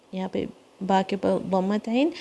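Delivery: ordinary talk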